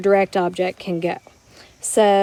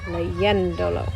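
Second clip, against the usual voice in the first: slow "leyéndolo", stressed on the syllable yén; "leyéndolo" slowly, leaning on yén, ending light; accented E; 3 semitones lower, 4 dB quieter, slower than usual. Shown as a demonstration. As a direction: draw out "leyéndolo" with the emphasis on its E. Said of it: "So that's what I did here".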